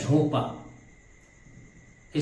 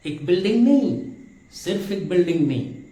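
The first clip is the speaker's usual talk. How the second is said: louder and fast, punching नहीं; emphatic denial; 3 semitones higher, 4 dB louder, faster than usual. Real, not acted. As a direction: hammer the नहीं home. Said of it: "teacher stressing a contrast". (नहीं पर ज़ोर देकर, तेज़ी से)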